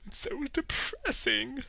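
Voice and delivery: high, slow hammed-up whine; mock despair. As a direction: wail it softly in fake misery. Mock lament over missing podcast.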